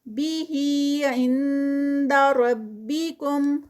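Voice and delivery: slow drawn-out tajweed recitation, vowels held; Quran recitation. Shown as a demonstration. Tajweed teacher reciting a verse for students, slow melodic recitation. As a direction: chant each word with its lengthening. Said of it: "ٹو counts کا stretch".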